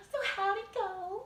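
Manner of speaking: high, eager gossip; high-pitched giddy question; excited. Shown as a demonstration. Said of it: "And she's so excited".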